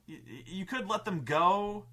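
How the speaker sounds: usual speaking voice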